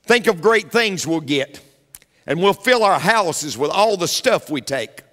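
ordinary delivery

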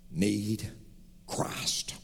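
whispered "need Christ"; hushed. Real, not acted. Preacher pressing the point to the congregation, hushed whisper. Sermon climax dropping to hush.

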